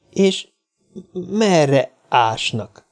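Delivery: frightened little man asking about the bones, halting; nervous stammer. A narrator's reading. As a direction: drag the words out uneasily.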